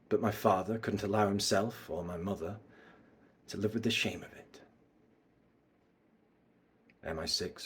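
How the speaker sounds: low, hushed villain confession; sinking almost to a murmur, long pauses; mysterious bad guy. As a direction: recount a family betrayal softly and low. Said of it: "bad guy mysterious vibes".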